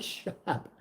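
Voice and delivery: urgent whisper; whispered shut up; whispered hush. Retold or imitated. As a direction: whisper it so nobody hears. Hushing him in the restaurant.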